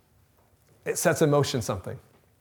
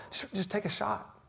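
much softer hushed plea; hushed urging; 5 semitones higher, 12 dB quieter than usual. Acted. Preacher softly urging doubters to try. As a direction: drop to a hush and urge.

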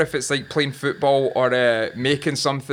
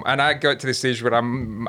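usual speaking voice

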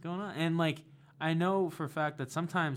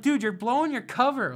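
mock exasperated yell; 11 semitones higher, 11 dB louder than usual. Yelling at radio caller.

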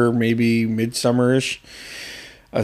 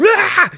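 startled yelp; 3 dB louder than usual. Retold or imitated. Startled awake by the earthquake.

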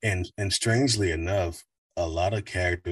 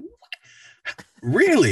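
surprised; 8 dB louder, much slower than usual. Retold or imitated. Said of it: "And they're like".

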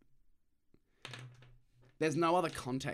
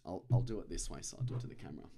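usual speaking voice

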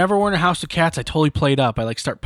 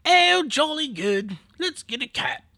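high, slow mock-British voice; polite British impression; 11 semitones higher, slower than usual. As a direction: put on a polite, high-pitched British accent. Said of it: "he's British, so he's polite".

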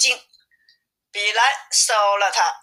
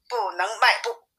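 ordinary delivery

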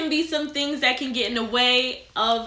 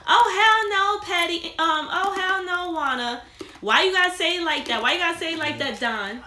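higher, sing-song mock outrage; outraged women; 6 semitones higher than usual. Host imitating offended women scolding her, sing-song mock outrage. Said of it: "majority of them probably gonna be like".

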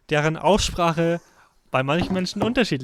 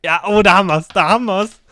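loud, high "da haben wir's" of delight; triumphant gotcha; 5 semitones higher, 11 dB louder than usual. Real, not acted. Friend crowing over the other's odd pronunciation, loud and triumphant. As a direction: crow over catching him out.

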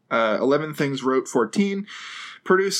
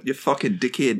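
Aussie attempt sliding into New Zealand; mock Antipodean accent, sounding more Kiwi; 3 semitones lower than usual. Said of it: "that's more in New Zealand".

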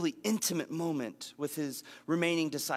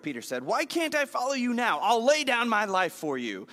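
higher, louder eager vow; a touch of Peter's fervent vow; earnest Peter protest; 8 semitones higher, 5 dB louder than usual. Retold or imitated. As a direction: Peter insisting, all in.

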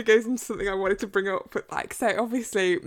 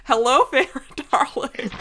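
slow, loud, higher mock-Scottish ogre; Shrek; 5 semitones higher, 8 dB louder, slower than usual. Host repeating the Scottish Rhys joke, loud mock ogre voice. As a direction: belt it out as a hammed-up Scottish ogre. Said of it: "In a Shrek voice".

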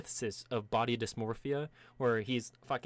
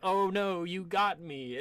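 mock defeat; 7 semitones higher, 3 dB louder, slower than usual. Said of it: "loses on purpose".

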